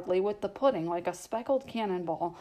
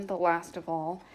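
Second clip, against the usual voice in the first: ordinary delivery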